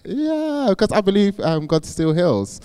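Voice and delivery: eager believer. Retold or imitated. Telling sceptical strangers God heals, eager and high. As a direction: insist with wide-eyed certainty. Answering doubters on the street.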